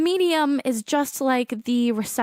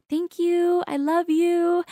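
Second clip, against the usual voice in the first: high, sustained sing-song; affectionate sing-song, held notes; sing-song greeting; 5 semitones higher, slower than usual. Retold or imitated. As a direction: lilt it up high to a loved one. Voices her greeting to a sign.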